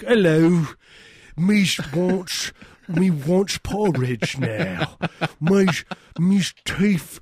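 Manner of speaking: mock English accent. Comedian imitating an English pub local in a hammed-up accent. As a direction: play a dopey English bloke. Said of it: "when I go to England, I'm like".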